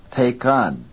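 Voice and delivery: TAKEN wrongly stressed on KEN; wrongly placed stress. Shown as a demonstration. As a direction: put the weight on the second syllable of TAKEN. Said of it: "Is this acceptable English?".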